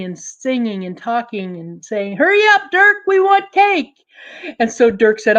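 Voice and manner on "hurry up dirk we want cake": put-on very high, loud kids' call; children calling out. Cousins calling Dirk from across the path. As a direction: shout across like impatient kids.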